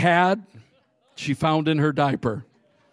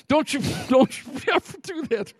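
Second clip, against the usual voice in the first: scolding through laughter; 10 semitones higher than usual. Retold or imitated. Parents scolding, stifling laughs.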